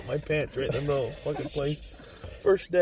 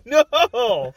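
loud refusal; 7 semitones higher, 7 dB louder than usual. Retold or imitated. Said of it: "And I'm like".

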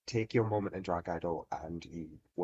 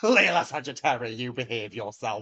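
mock scolding; 4 semitones higher, 6 dB louder than usual. Mock scolding a co-host after joke.

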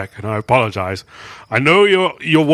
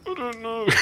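helpless laughing; 13 semitones higher than usual. Real, not acted. Player stumped by the character's demand, laughing helplessly. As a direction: admit it while collapsing into giggles.

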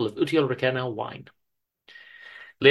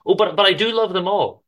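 insisting; 7 semitones higher, 4 dB louder than usual. A narrator's reading. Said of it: "insisted Penny".

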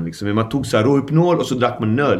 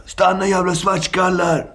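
mock police shout; 8 semitones higher than usual. Police shouting racist slur, retold.

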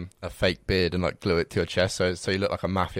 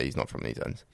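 wary locals; 7 semitones lower, 7 dB quieter than usual. Retold or imitated. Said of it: "they're gonna be like".

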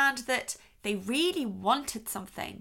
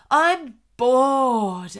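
louder mock whine of a bored kid; bored child; 8 dB louder than usual. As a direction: drag it out like a sulky child. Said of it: "the other one's going".

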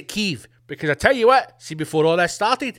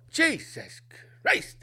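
stunned exclamation; 10 semitones higher, 4 dB quieter, slower than usual. Real, not acted. Imagining Putin handing passport.

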